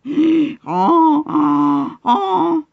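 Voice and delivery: loud, high honking bray; donkey bray. Acted. Donkey braying to the circle of animals. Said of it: "the donkey began to bray".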